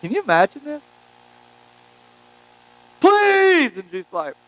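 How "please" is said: a mother's desperate high cry for help; desperate plea. Retold or imitated. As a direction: beg him desperately, voice soaring high. Woman pleading.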